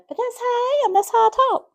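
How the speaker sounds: high, whiny retort to grandmother; mock-whining like a stubborn kid; childish protest. Young girl answering her grandmother, high whiny protest. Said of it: "And I'd say".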